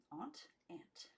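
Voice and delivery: ordinary delivery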